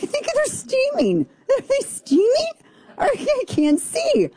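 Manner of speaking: very high-pitched comic distress; mock panicked squeal. Host acting out her steamed-up glasses, mock panic. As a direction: squeak it in hammed-up alarm.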